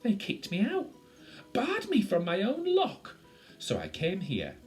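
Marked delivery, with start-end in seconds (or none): 0.0-3.2 high, loud, aggrieved complaint; indignant. Acted. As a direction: protest the injustice loudly. Creature complains of being thrown out.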